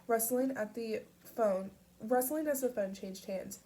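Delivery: ordinary delivery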